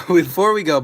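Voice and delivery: loud laughing before we go; laughing call. Real, not acted. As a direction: laugh and shout it out to stop the ending. Repeats call to stop stream.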